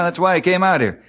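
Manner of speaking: ordinary talk, nothing marked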